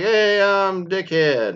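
mock slow goofy intro. Student at summer graduation, drawn-out dopey intro. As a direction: play the dumb kid stretching out his name.

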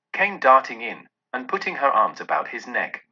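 plain reading voice

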